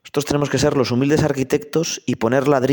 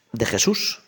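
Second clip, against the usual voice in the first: ordinary talk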